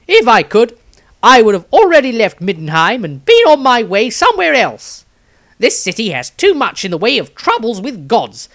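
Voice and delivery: high, loud, restless character read; Maestro's diary voice. Acted.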